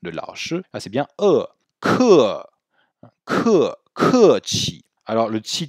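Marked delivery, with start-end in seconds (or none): 1.8-3.8 "Ke" with a puff of air after the k and an open e; aspirated ke. Shown as a demonstration. Teacher drills the aspirated "Ke", loud and clear. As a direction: breathe out hard on the k of "Ke". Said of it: "Et le E est très ouvert ici".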